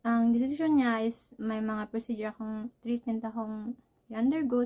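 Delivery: ordinary talk, nothing marked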